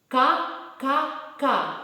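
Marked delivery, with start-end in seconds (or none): none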